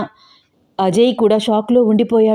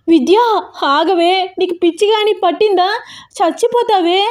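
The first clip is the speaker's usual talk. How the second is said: high-pitched, alarmed yell; shouting; 10 semitones higher than usual. A narrator's reading. Mother yells after her daughter to stop, panicked shout. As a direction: cry out urgently, pitch leaping high. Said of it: "అరుస్తోంది స్వప్న గట్టి గట్టిగా".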